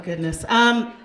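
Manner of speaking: ordinary talk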